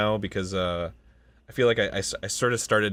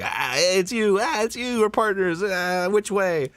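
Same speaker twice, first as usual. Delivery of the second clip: lifted, higher cartoon voice for the duo; Gato duo voice; 9 semitones higher, slower than usual. Voice actor replaying the duo's greeting, higher cartoon voice. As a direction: play the clueless sidekick meeting his partner.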